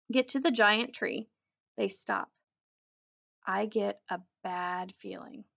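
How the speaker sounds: ordinary delivery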